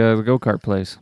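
ordinary talk

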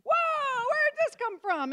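very high, loud, taken aback; startled. Retold or imitated. Husband stunned by his wife's attack, high surprised yelp. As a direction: caught off guard by her outburst. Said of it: "he's like".

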